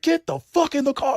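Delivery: retold yell at the bear-petting women; snapping order. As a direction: snap at them to get in the car. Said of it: "Like, he snapped".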